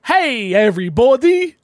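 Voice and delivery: "everybody" with "every" drawn out loud and high; stretched every. Shown as a demonstration. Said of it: "Like, every".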